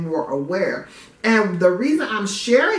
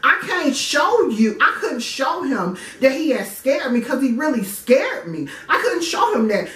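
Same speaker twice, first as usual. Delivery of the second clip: usual speaking voice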